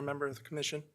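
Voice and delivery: ordinary talk, nothing marked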